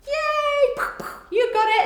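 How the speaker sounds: high, loud cheer; excited classroom yay. Acted. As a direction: cheer the kids on with a big yay. Teacher cheering pupils on.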